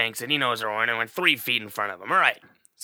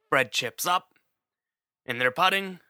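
ordinary talk, nothing marked

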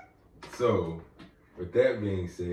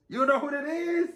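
very high, incredulous repeat of the question; offended disbelief. Retold or imitated. Podcaster retelling shock at a guest's question, offended disbelief. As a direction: squeal it as if personally offended. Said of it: "Y'all know how offended I was?".

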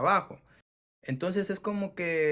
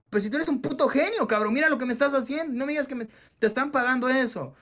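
much higher, louder, quick excited praise; 10 semitones higher, 4 dB louder than usual. Retold or imitated. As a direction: hype him up in disbelief. Praising underpaid programmer.

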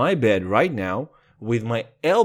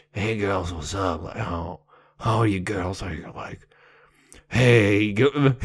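Hank Moody voice; 6 semitones lower than usual. Retold or imitated. Teenage him greeting classmates in a low mock-gravel voice. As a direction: greet the girls in a low, mock-cool rasp. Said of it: "in this voice".

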